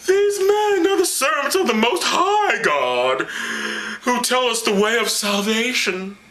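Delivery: high, slow proclamation; crying-out possessed girl. Acted. Possessed girl cries out after Paul and Silas, high. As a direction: call it after them like an oracle. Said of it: "she followed them and cried, saying".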